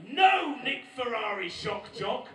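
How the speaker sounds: high, loud and slow pedantic outrage; indignant mock argument. Comedian arguing geography with a radio shock jock, indignant shouting. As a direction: thunder each correction slowly.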